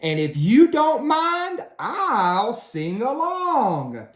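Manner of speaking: a hint of a chirpy bird; little bird politely asks to join the song. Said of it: "A little bird tweeted".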